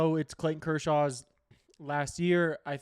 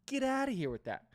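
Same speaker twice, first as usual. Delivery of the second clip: higher, scoffing repeat; incredulous dismissal; 10 semitones higher than usual. Real, not acted. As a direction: brush it off, pitch jumping up on outta. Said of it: "repeated, pitched way up".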